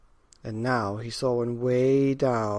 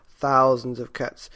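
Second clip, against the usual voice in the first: plain reading voice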